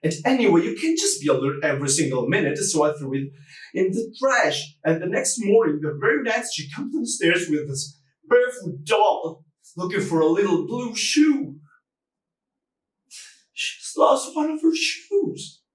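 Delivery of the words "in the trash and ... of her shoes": higher, upset retelling; distressed confession. Acted. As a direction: let the distress lift the voice as you confess. Confessing the lost shoe, upset.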